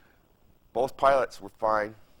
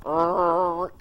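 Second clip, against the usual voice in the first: lawnmower noise; 5 semitones higher, 6 dB louder than usual. Retold or imitated. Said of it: "took a lawnmower and went".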